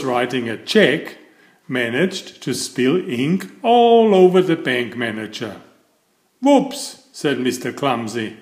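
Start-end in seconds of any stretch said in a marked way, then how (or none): 6.4-7.1 high squeaky comic whoops. A narrator's reading. Mister Clumsy fumbling the hot letter, high comic whoops. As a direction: chirp it up like a little oops. Spills ink on bank manager.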